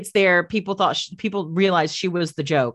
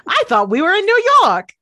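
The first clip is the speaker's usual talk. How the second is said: higher, louder playful exclamation; mock surprise; 12 semitones higher, 6 dB louder than usual. Teasing the co-host, mock surprise. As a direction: act baffled. Playing shocked townsperson in scene.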